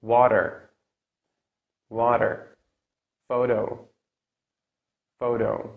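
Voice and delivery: American accent, middle T's as quick D's; American flap T. Shown as a demonstration. Teacher models words for learners, American flap T. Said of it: "let's do the American now".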